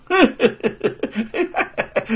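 high scornful guffaw; mocking laugh. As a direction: laugh in his face. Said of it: "罗鹏笑道". (轻蔑的大笑)

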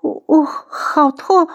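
high, slow, faltering moan; drained, halting, high-pitched agony; weak with pain. A narrator's reading. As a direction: squeeze the words out through pain. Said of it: "青姨说话都没了力气".

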